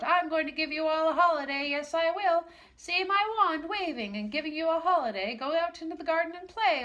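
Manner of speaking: high, loud joyful proclamation; gleeful Pixie. Acted. Pixie waves wand giving brownies a holiday, gleeful. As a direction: announce the holiday with glee.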